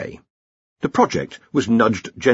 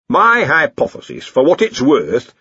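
slow, higher donnish voice; theorising lecturer; 6 semitones higher, 5 dB louder, slower than usual. Acted. Lecturer offers his theory to the wizards, donnish.

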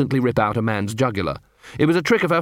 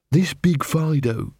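slow, higher-pitched character question; inquiring werewolf; 4 semitones higher, slower than usual. Acted. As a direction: probe slowly and a little high. Angua questions the poodle.

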